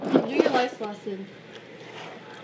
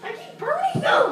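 very high, loud frustrated wail; real high-pitched frustrated outburst; frustrated yelling; 5 dB louder than usual. Real, not acted. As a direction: yell back in exasperation. Yelling back about burnt food.

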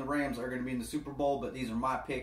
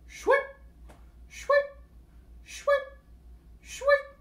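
high-pitched swishing "shwip"; short, spaced-out squeaky swooshes, one at a time; quoted sound effect; 6 dB louder, much slower than usual. Retold or imitated. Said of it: "A wise man once said".

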